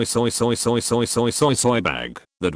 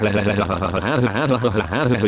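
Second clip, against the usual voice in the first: breathy mock villain cackle; hammed-up wicked laugh; evil cackle. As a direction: cackle like a gleeful cartoon villain. Cackling before the villain line.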